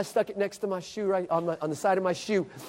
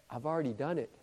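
sudden low, soft reply; hushed confession; 8 semitones lower, 15 dB quieter, faster than usual. Retold or imitated. Ray Ray answers the invitation to pray, hushed and low. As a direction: drop right down to a quiet, low voice.

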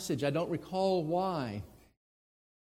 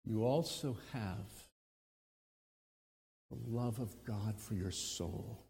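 soft and low, gentle; tender reassurance; 7 semitones lower, 11 dB quieter than usual. Real, not acted. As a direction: comfort the hurting listener.

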